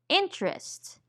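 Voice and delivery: "interest" as two syllables, IN-trist; dropped schwa syllable. Shown as a demonstration. Teacher giving another casual-speech example, schwa dropped. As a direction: say "interest" with the middle vowel gone. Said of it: "may disappear completely in casual speech".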